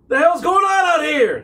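high, loud gruff sailor yelling; Sammy's shout. Acted. Sammy yells at the strangers by his ship, high and loud. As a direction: bark it out as the angry shipowner. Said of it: "Sammy says".

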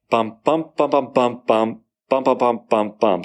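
uneven beats sung out as bum, bum; irregular rhythm. Shown as a demonstration. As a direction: tap out the line's broken rhythm on bum. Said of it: "unlike the first line".

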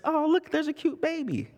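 put-on high, gushing baby-talk coo; cooing over a baby. People spotting a baby, a high gushing coo. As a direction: gush like someone spotting a cute baby. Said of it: "there's this".

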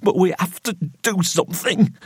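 high, desperate voice close to breaking; cracking, near sobbing. A narrator's reading. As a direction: plead as if about to break down. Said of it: "crack in his voice as if he might break down and sob".

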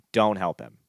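normal talking voice

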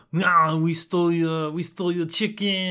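high, loud hammy fake German accent. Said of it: "their best fake German accent".

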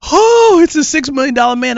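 very high, loud gasp of awe, then quick; a little boy spotting his hero; starstruck kid. Retold or imitated. Boy meeting Lee Majors on set, awestruck and high. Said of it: "I was like".

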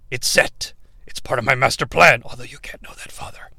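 villain muttering a secret; secret whispered aside. Acted. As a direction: drop to a whisper for the hidden plan.

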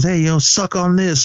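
high-pitched imitated jeer; mock taunt. [name] running up to the woman, mock taunting.

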